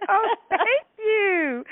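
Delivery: high, loud, very slow and gushing; gracious author. Retold or imitated. Author thanking a fan, high gushing coo. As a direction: coo it out like a flattered Southern lady. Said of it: "I said".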